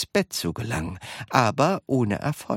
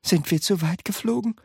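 put-on higher-pitched, unforced child's question; asking boy; 10 semitones higher, faster than usual. A narrator's reading. Charlie asking Wonka, questioning boy's voice. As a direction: ask it in a lifted boy's voice. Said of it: "fragte Charlie".

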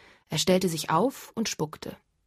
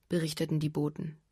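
usual speaking voice

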